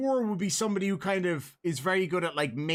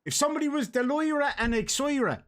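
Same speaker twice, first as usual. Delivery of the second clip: lightly put-on Dublin accent, raised pitch; Dublin slang phrase; 8 semitones higher than usual. Shown as a demonstration. Host quizzing co-host on Dublin slang, Dublin accent. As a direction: voice it as a Dubliner would. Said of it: "this one is specific to Dublin".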